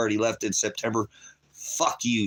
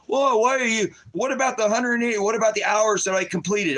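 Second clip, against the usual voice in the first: much higher, louder, indignant; higher-pitched protesting son; quoting son; 10 semitones higher, 3 dB louder than usual. Retold or imitated. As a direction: voice the son pleading his case. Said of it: "My son's".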